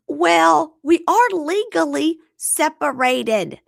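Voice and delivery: high, loud, drawn-out protest; mock pleading woman. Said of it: "she says".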